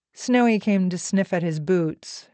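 usual speaking voice

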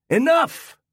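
husband yelling at his wife; yelled. Acted. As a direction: blow up at the nagging wife. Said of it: "he yelled".

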